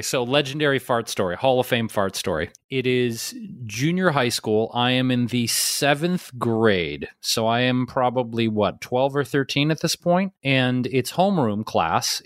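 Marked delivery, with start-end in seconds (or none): none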